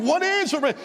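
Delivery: high-pitched eager pleading; urgent repeated question, jumping up in pitch; retold urgent questioning. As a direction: reenact pressing a wife for news. Acts out pressing wife for news.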